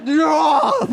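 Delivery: long loud high scream; imitated screaming dog, high and loud; dog waking up screaming. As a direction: jolt awake with a drawn-out human scream. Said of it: "how it wakes up every day".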